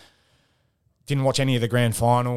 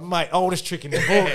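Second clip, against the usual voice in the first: laughing imitation; 7 semitones higher, 4 dB louder than usual. Grigor consoling, retold.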